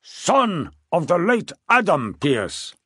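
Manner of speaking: king declares it slowly and emphatically; very emphatic. A narrator's reading. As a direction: stress "late" with a long falling tune. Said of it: "his voice becoming very emphatic".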